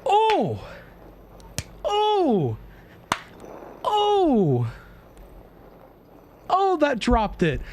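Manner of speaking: real gamer hype at a huge score; high, thrilled exclamations; excited shrieks. Real, not acted. Hitting record score on aim test.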